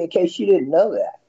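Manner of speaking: ordinary talk, nothing marked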